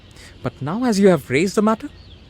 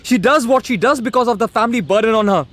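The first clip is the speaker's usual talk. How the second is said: heated protest; 6 semitones higher, 4 dB louder than usual. Acted. Son argues with mother.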